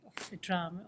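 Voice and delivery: normal talking voice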